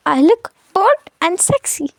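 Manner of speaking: put-on slow, teasing copy of a little girl; mock the kid's grown-up line; mimicking the child. Preeti laughingly mimics her little girl. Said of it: "इस बच्चे को देखो".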